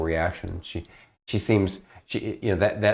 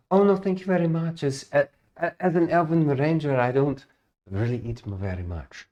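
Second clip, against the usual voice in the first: put-on higher, sweet, reserved young elf; elf ranger voice; 7 semitones higher than usual. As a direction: speak as a sweet shy elven ranger, a little higher.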